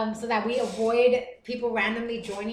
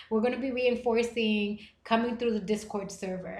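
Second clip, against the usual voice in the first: normal talking voice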